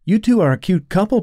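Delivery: playful tease with a smile; smiling. Acted. Daughter teasing the two detectives, smiling. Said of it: "Sharita smiled at them".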